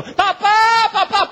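put-on high, loud child's desperate cry. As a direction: call out to papa from the altar, desperate. Isaac calls from the altar.

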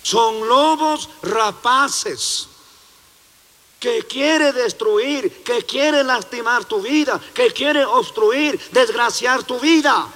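slow, heavy preaching of "Son lobos rapaces". Acted. Preacher warning his flock about false pastors, heavy preaching. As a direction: drive the warning home line by line.

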